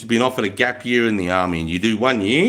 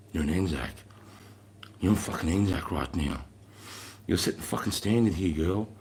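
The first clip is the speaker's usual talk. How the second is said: low, much softer, urging her on; softer voice, steady and close to her ear; hushed encouragement; 6 semitones lower, 9 dB quieter than usual. Retold or imitated. Corporal softly urging a limping recruit to keep going, hushed.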